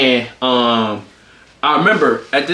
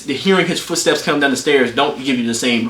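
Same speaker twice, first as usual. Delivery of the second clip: ordinary delivery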